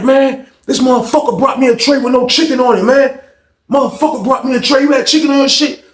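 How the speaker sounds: high-pitched, aggrieved yelling to a neighbor; acted angry complaint. Inmate telling neighbor about chickenless tray, acted angry complaint. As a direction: mimic an outraged inmate's complaint.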